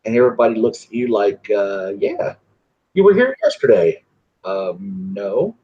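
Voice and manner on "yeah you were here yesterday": much higher, sing-song mock clerk voice. Host mimics clerks insisting she visited yesterday, high sing-song. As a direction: parody the overly familiar clerk.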